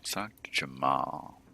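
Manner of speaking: slow, breathy whisper; slow hushed parting whisper. Acted. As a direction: lean in and whisper the tip.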